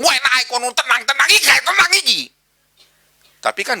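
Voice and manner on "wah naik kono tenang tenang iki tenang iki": high, louder hurried calming; urgent comforting. Pretend. Preacher imagines calming a friend, urgent and loud. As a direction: soothe someone in a panic, urgently. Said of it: "aku ngomong".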